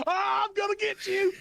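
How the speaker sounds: high, loud mock villain shout; mock menacing shout. GM voices attacking devil, laughing.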